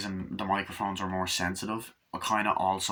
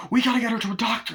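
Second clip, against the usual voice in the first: urgent plea; 13 semitones higher, 5 dB louder, faster than usual. Retold or imitated. Mother insists, urgent.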